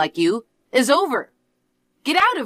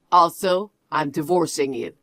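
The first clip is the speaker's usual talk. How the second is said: lower, cold lecturing voice; condescending husband; 5 semitones lower than usual. Acted. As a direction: talk down to your wife, low and smug. Husband voice again.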